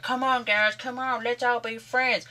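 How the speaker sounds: high put-on pleading voice; mock peacemaking plea. QPR players calming everyone down, high mock pleading. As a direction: plead sweetly as a hammed-up peacemaker.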